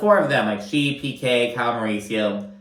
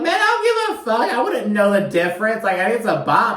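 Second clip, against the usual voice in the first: gushing excitement; 9 semitones higher, 3 dB louder than usual. Retold or imitated. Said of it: "And I'm like".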